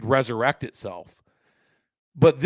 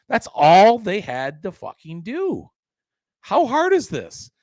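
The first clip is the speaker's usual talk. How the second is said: exasperated, drawn-out and higher-pitched; 8 semitones higher, 4 dB louder, slower than usual. Real, not acted. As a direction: stretch the words out in real exasperation. Ranting at the organization's failure.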